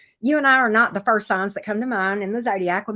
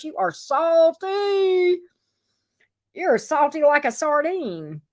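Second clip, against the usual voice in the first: drawn-out tease; 6 semitones higher, slower than usual. Real, not acted. Teasing reader.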